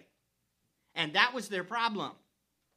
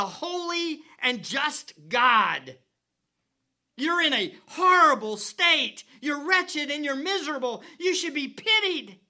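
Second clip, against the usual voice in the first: louder, higher, driving; fervent preaching; 8 semitones higher, 7 dB louder than usual. Real, not acted. Preacher warning the congregation, raising his voice. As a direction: preach it with full force.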